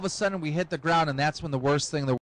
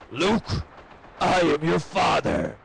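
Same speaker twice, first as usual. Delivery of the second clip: slow, ominous movie-villain voice; Darth Vader parody; slower than usual. Retold or imitated. Father walks into son's room, slow villainous proclamation. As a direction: intone it slowly like a dramatic reveal.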